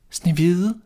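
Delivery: put-on frightened girl giving her name; timid girl. A narrator's reading. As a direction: answer in a small uncertain voice.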